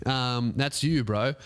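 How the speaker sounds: ordinary delivery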